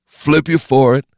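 high, loud thug offering a bet; high-pitched goon voice; first gunman's voice. Acted.

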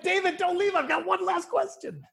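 high-pitched retold plea; pleading high, half in jest; mock urgent plea. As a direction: play at calling someone back urgently. Acts out calling after departing guest.

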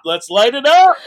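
even bigger, full hype shout. Retold or imitated. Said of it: "I'm like".

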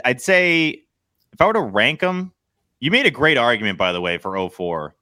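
ordinary talk

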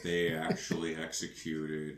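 low, soft droning bore; Colin Robinson. Pretend. Co-host joining in as the boring energy vampire, droning. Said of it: "energy vampire in the house".

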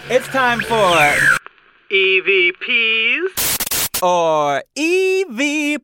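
high, slow spooky announcer voice; unearthly voice. Pretend. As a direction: draw it out like a ghost.

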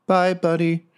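high, light goblin voice; a small goblin calling down a mock farewell. Goblin calling down the chimney to his dead friend, high and light. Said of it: "you hear from up top".

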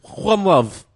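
put-on loud, high Serbian accent with a Spanish-style J; hammed-up Serbian accent. Co-host milking the bit, loud Serbian accent. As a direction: bellow it as a heavy Serbian caricature. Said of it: "I do the accent".

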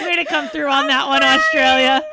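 laughing through the words, loud; cracking up while cheering Australia on. Real, not acted. Laughing at banned name list.

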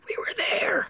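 hoarse whispered demon rasp. Retold or imitated. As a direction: croak it out in a wrecked demon voice. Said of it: "with my demon voice".

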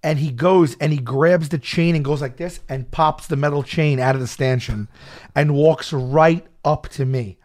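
ordinary delivery

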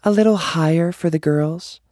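high fake streamer voice for fans. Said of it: "all using their second voices".

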